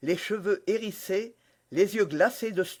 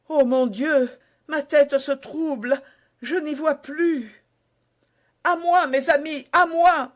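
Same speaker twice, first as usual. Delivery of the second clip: high, loud frightened cry for help; dizzy woman crying for her friends; fainting panic; 9 semitones higher, 7 dB louder than usual. Acted. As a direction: call out as vision fails. Constance poisoned, fainting.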